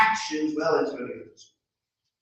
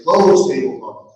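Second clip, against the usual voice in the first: command; 11 dB louder than usual. Acted. Magic command.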